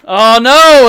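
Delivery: sudden loud oh no at the game; loud groan of dismay; dismayed cry. Real, not acted. Gamer groaning at another interruption, loud dismay.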